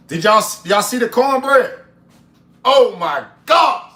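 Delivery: high, loud thrilled exclaiming; loud delighted outburst; excited. Real, not acted. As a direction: show the chat, thrilled. Excited showing off food.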